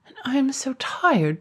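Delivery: weary dragon. Acted. Dragon complains of tiredness.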